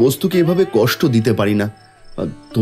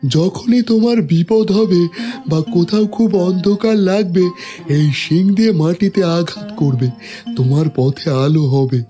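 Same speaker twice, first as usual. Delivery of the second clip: a bit higher, thankful animal voice; grateful goat voice; 5 semitones higher than usual. Acted. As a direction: thank him like a gentle goat. Goat gives a parting gift.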